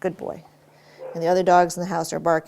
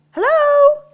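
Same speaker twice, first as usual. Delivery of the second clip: very high, loud doorbell hello; loud distraction hello; 12 dB louder than usual. Shown as a demonstration. Trainer testing the dog with a loud greeting, high and loud. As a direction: sing it out like greeting a guest at the door. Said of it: "when I say it".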